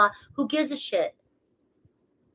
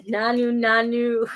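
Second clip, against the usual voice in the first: Robin Williams; slower than usual. Retold or imitated. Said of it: "Channeling Robin Williams".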